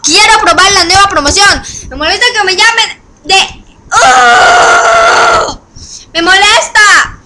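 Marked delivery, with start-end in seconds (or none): 3.9-5.6 high loud frustrated cry; frustrated shout. Real, not acted. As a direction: burst out with an annoyed yell. Grito de frustración.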